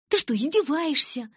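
high, loud indignant outburst. Acted. Narrator interrupting Калин's talk of the dance, indignant. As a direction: cut in, incredulous and offended.

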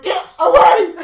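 high, loud shout; retold panicked yell. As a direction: yell it to fend off an attacker. Retold fighting off nightmare attacker.

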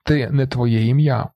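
plain reading voice

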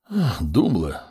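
name spoken on a sigh. A narrator's reading. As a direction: let out a light sigh as you address him. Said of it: "со вздохом произнёс". (со вздохом)

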